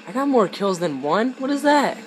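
high, loud incredulous question; loud disbelief. Real, not acted. Gamer baffled by his kill count, loudly incredulous. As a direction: protest in disbelief, pitch leaping up.

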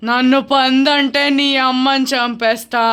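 slow spooky retort; threatening ghost. Pretend. Ghost answers child's insult, slow and menacing. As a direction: stretch the warning out as a spirit.

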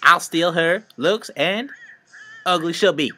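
high cackling witch rhyme. Acted. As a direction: threaten like a storybook witch. Witch rhyming threat.

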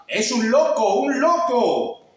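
put-on children's taunting yell, high and drawn out; crowd shouting. A narrator's reading. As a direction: shout it like a mocking street crowd. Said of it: "que gritaban".

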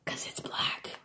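hushed, voiceless whisper; whispered aside. Real, not acted. Crafter explaining a marker's name to viewers, whispered. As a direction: whisper it like a little secret.

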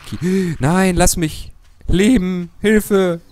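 hohe gespielte Panik; panisch. Pretend. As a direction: kreischend um Hilfe rufen. Zombie greift an, Panik. (hoch und laut in gespielter Panik)

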